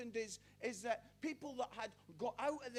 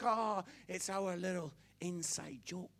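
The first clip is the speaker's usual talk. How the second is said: smug aside; 4 semitones lower, 5 dB louder than usual. Acted. Mocking insiders' smug aside.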